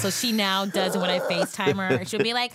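ordinary talk, nothing marked

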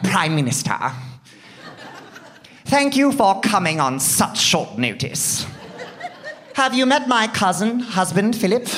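high, regal mock-British voice; the Queen. Monarch greets the Prime Minister, regal British accent. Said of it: "all British people spoke like the Queen".

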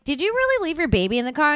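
confronting the mother at the car, indignant; indignant confrontation. Retold or imitated.

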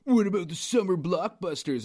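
lightly mocking whiny imitation of a complaint; mocking quote. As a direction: imitate a co-host's pestering question. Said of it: "and you're like".

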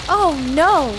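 hammed-up shriek of dismay; mock alarmed cry. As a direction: yelp it in mock panic. Mother cries at disaster.

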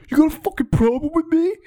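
high, strained yell imitating an angry kid; mock angry challenge. Kid stands up to confront teens in theater, mock angry challenge.

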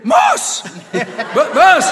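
loud, very high sputtering screech; mock shriek. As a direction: ham it up, choking on rage. Father sputtering in rage. (el padre chilla furioso, trabándose)

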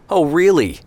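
a high, loud, mocking "Oh, really?"; sarcastic. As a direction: throw it back at his brother with open scorn. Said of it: "Isaac said sarcastically".

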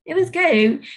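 light, sunny recap of the day; cheerful self-talk. Retold or imitated. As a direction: reassure yourself that the day went fine. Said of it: "and I'm like".

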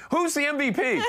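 high-pitched mock yell; retold yelling. Retelling chaos on the podium, high mock yell. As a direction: yell the question over a crowd, half laughing. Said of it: "so I'm yelling".

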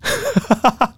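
short squealing laugh; high embarrassed burst of laughter. Real, not acted. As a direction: laugh at getting caught red-handed. Caught by mom at gate.

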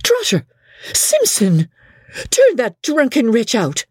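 high-pitched furious summons; mistress's scream, held back in volume; mild acted scream. A narrator's reading. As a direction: order them sharply, voice pitched well up. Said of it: "screamed Missus Crawley".

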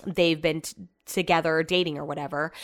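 normal talking voice

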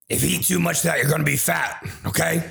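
lightly voiced stern great-grandma; Grandma Shirey voice. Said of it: "I wish I could do a good Grandma Shirey voice".

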